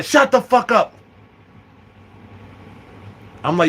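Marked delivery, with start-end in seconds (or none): 0.0-0.9 higher-pitched acted outburst; exasperated silencing. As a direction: cut off your friend in mock exasperation. Silencing his witness.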